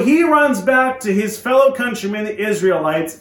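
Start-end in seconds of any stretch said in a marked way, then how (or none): none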